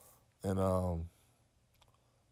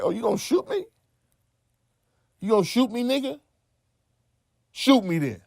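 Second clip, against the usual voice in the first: much higher, louder mocking challenge; taunting dare; 12 semitones higher, 3 dB louder than usual. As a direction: dare him to pull the trigger. Daring a man with a gun.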